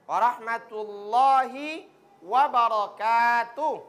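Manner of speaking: slow model with clear ra in wabarakatuh; careful recitation. Shown as a demonstration. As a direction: stretch out Wabarakatuh, ra sounded clearly. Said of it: "Ra-nya". (contoh ucapan ra yang jelas)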